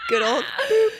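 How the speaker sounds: high laugh-cry through the words. Real, not acted. Laughing over the boop story.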